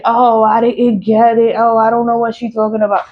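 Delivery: sarcastic impression of an office clerk feigning ignorance; mock clerk excuse. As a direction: imitate a clerk pretending not to know. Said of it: "you could say".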